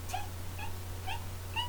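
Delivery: very high squeaky mouse giggle. Shown as a demonstration.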